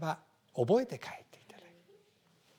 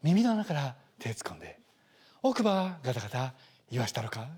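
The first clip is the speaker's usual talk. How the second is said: Morimoto Leo style; 6 semitones lower than usual. Retold or imitated. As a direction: make the threat sound soothing. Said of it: "脳内で森本レオに変換する".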